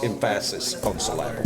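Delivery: "emphasis" as em-FASS-is, "syllable" as sil-LAB-ul; stress on wrong syllable. Shown as a demonstration. Councilman explaining his joke, "emphasis" and "syllable" stressed on the wrong syllable. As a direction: hit the middle syllable of "emphasis" and of "syllable". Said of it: "if you put it on the emphasis on the wrong place".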